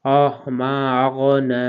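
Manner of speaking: আগনা with the গইন from the back of the throat, slow; throat letter. Shown as a demonstration. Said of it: "হলকের শেষ ভাগ থেকে উচ্চারিত হবে". (গলার শেষ ভাগ থেকে আগনা)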